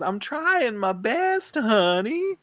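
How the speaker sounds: put-on little girl's drawn-out, high southern twang; southern accent. Little girl protesting to her mom, high southern accent. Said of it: "like, a southern accent".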